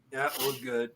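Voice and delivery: quiet forced okay through gritted teeth. Retold or imitated. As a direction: squeeze it out through clenched teeth. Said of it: "Grit my teeth".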